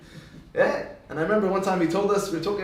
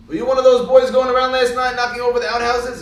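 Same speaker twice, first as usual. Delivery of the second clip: loud, raised-pitch questioning of a son; stern interrogation; 6 semitones higher, 7 dB louder than usual. Acted. Father confronts Tony Junior about last night, stern interrogation. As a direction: demand an answer as an accusing father.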